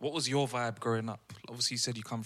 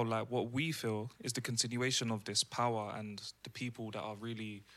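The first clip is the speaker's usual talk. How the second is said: ordinary talk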